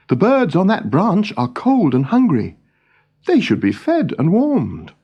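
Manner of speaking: put-on a young king fretting over his birds.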